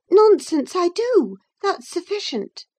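high, dismissive retort; high-pitched, impatient young lady; Catherine. A narrator's reading. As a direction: brush it off in a high voice, starting loud. Catherine dismisses Nelly's questioning.

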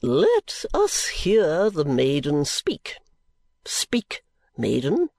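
oily preacher voice, drawn out; pompous preacher. Acted. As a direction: play the greasy self-satisfied preacher. Chadband sermon.

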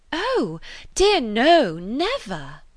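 slow, wide-eyed innocent refusal; shy girl protesting sweetly, drawn out; naive. A narrator's reading. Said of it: "with a very interesting naivete".

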